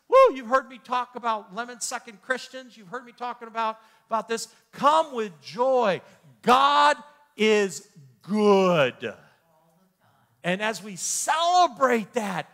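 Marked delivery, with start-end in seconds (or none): 4.7-6.0 loud slow call. Acted. Preacher exhorting the congregation. 6.4-9.1 loud proclamation. Acted. Preacher shouting God's goodness to the church.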